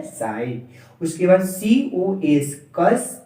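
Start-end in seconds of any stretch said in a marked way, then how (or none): 0.0-0.6 साइन with a gliding "eye" vowel; correct reading SINE. Shown as a demonstration. Teacher correcting students' reading, साइन calmly said as "sine". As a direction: give साइन as the right reading, rhyming with "line". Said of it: "इसको पढ़ना है साइन".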